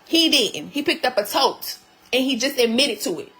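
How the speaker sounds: ordinary delivery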